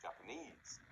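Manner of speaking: Japan-ESE, stressed at the end; final-syllable stress. Shown as a demonstration. Teacher modelling a word to repeat, last syllable stressed in Japanese. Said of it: "It can also be at the end".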